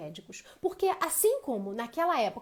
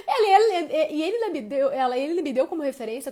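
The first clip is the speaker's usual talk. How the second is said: much louder, higher outraged telling; loud, higher indignant outburst; indignant burst; 3 semitones higher, 10 dB louder than usual. Real, not acted.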